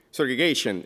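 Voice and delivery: ordinary talk, nothing marked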